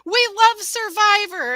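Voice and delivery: chirpy cheer that hides tears; fake cheery contestant. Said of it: "as they're in the corner crying".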